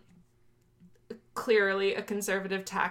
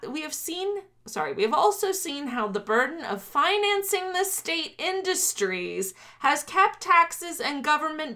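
raised, oratorical political read-aloud; formal manifesto reading; 6 semitones higher than usual. Acted. Host reading privatization section to co-host, declaimed. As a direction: declaim it in rising, emphatic phrases.

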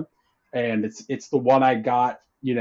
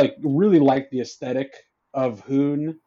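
usual speaking voice

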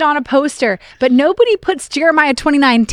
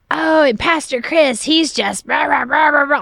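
mock ranting, words dissolving into blather; imitated rant; 3 semitones higher than usual. Retelling an overheard voicemail about the pastor, mock ranting. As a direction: run down a pastor in a mimicked tirade. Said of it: "going off the rail about how awful we were".

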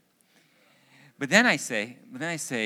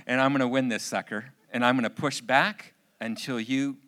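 loud, forceful vow; combative inner voice; 4 semitones higher, 10 dB louder than usual. Retold or imitated. Father vows inwardly to push back at daughter, combative and loud. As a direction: act out a dad set on winning. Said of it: "This is what's going on in my head".

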